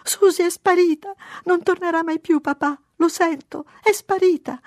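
put-on very high, loud child's wail; sobbing. A narrator's reading. Mary tells papà Susie is gone, sobbing. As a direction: wail like a distraught little girl. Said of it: "singhiozzò".

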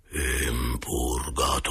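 low, slow, drawn-out spectral moan; eerie low ghost voice, vowels trailing away; thread-of-voice ghost. A narrator's reading. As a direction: moan it faintly like a spirit from beyond. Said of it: "risposi con un fil di voce".

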